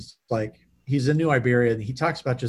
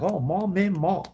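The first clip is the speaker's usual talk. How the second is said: higher-pitched imitated New Orleans accent; 5 semitones higher, slower than usual. Imitating an old coworker from New Orleans, New Orleans accent. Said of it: "have a phrase to get you into".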